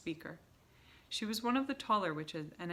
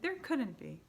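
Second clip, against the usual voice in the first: doubting; 6 semitones higher, 3 dB louder than usual. A narrator's reading. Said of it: "the others shouted".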